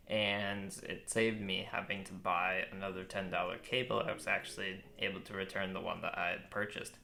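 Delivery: ordinary talk, nothing marked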